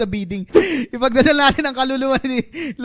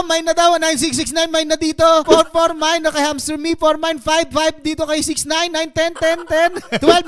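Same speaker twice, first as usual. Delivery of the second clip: auctioneer; 4 semitones higher than usual. Pretend. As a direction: call the bids like an auctioneer. Mock auction to chat.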